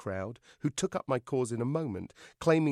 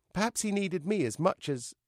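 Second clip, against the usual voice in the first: ordinary delivery, nothing marked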